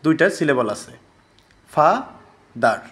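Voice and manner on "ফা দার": ফা, then দার, with a clear gap between; syllable by syllable. Shown as a demonstration. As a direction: sound out ফা and দার as two separate syllables. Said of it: "ফা একটা, দার একটা".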